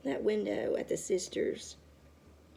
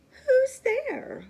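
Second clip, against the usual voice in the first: high, startled call into the dark; a sudden high cry of alarm from bed; startled little sister; 9 dB louder than usual. A narrator's reading. Baby woken in the night.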